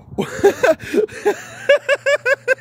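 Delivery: shrieking high laugh; real squealing laughter. Real, not acted. Laughing fit.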